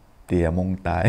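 hammed-up Isan dialect; Isan accent. Shown as a demonstration. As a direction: say เตี้ยมงตาย with a light Isan lilt. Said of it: "ใส่สลือนิดหน่อยเนาะ".

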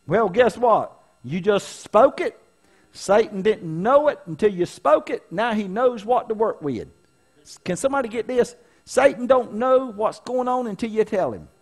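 loud, high, fervent preaching voice; preaching at full force. Acted. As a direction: preach it out to the church, full power.

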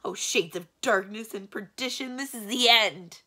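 loud, high, melodramatic wail; horrified yell. A narrator's reading. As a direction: yell it as if doom has struck. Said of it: "yelled the magician".

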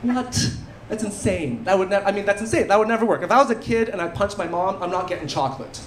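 ordinary delivery

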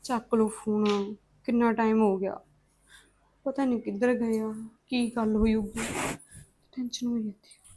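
fretting alone, barely voiced; worried murmur. Sister-in-law waiting for a call, anxiously murmuring to herself.

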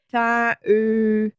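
high, muffled "thank you" round a fat tongue; swollen tongue. A narrator's reading. Woman stung on the tongue thanks Simon, thick-tongued. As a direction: say it as if the tongue fills the mouth. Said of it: "She can't speak because".

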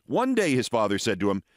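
ordinary delivery

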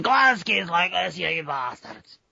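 rough mock Glaswegian, dragged out at the end; harsh Glasgow. Podcaster contrasting Glasgow with Edinburgh, harsh Glaswegian accent. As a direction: swear like a caricature Glaswegian. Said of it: "reputation for being hard to understand".

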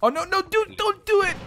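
high, urgent begging; real high-pitched alarm; panicked plea. Real, not acted. Pleading as a mishap looms.

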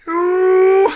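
high loud ooh; delighted squeal. Real, not acted.

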